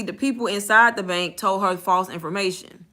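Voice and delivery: ordinary delivery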